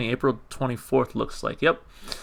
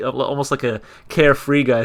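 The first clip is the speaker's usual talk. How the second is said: usual speaking voice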